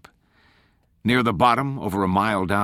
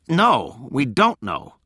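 higher-pitched, pointed retort; insistent, raised-pitch comeback; pointed prodding; 5 semitones higher, 3 dB louder, much slower than usual. A narrator's reading. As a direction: press them to finish the thought. Corky demands Tolland finish his sentence.

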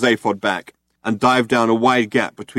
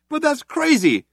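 high, raised protest; crying out. A narrator's reading. Trillian protesting to the cops, raised and high. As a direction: protest in disbelief. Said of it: "cried Trillian".

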